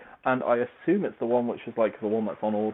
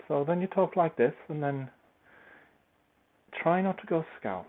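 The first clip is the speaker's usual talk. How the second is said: higher-pitched try at a Welsh lilt; tentative Welsh accent, raised in pitch; attempted Welsh accent; 6 semitones higher than usual. Shown as a demonstration. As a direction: have a go at talking Welsh. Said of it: "the problem is I do, like, let's have a go".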